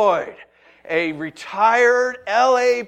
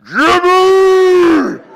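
huge, loud commanding bellow; retired cop roaring across the beach; booming cop shout; 11 semitones higher, 15 dB louder than usual. Retold or imitated. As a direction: bellow it tougher than nails. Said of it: "the voice you get when you are just, like, tougher than nails".

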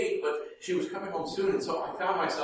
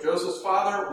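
ordinary talk

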